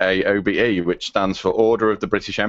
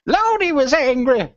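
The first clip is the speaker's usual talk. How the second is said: put-on very high, drawn-out accented mother voice; hammed-up impression of an indignant mother; South Park chef's mum; slower than usual. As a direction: stretch it out in a high, hammy cartoon-mum voice. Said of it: "the chef's mum's going".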